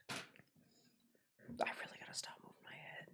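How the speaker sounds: whispered to self; breathy whisper under breath. Real, not acted. Dizzy, muttering to self.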